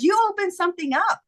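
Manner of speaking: high-pitched quote of an amazed daughter; daughter's revelation. Retold or imitated. Quoting daughter's excited conclusion.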